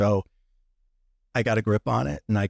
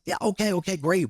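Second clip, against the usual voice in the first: excited; 10 semitones higher than usual. Retold or imitated. Retold excited reply, both crying.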